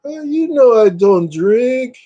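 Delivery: slow, high awkward reply; slow high awkward refusal; hero line. Retold or imitated. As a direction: stiffly refuse. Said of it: "He goes".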